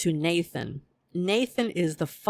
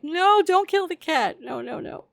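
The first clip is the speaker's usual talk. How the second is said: retold horrified plea; 12 semitones higher, 4 dB louder than usual. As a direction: plead in exaggerated horror for the cat. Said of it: "It was horrible. I was like".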